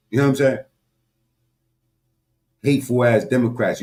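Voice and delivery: ordinary talk, nothing marked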